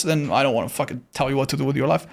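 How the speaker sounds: ordinary talk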